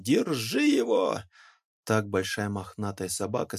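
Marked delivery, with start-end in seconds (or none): 0.0-1.2 slow yell up high, grabbing a thief; imagined yell. Retold or imitated. Narrator imagining the mask yelling at him to grab him: slow high shout. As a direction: bellow it out slowly, high and loud. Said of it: "ухватит меня за горло с воплем".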